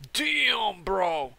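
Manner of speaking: high, stretched exclamation falling away; mock astonishment. Newbie to bro after coughing on a hit, astonished. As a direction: imitate a floored guy, pitch leaping up.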